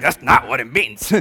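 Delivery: a hint of sassy attitude on the repeat; mimicking sassy women. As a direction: lightly mimic the ladies' sass. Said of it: "Did I see some attitude over here?".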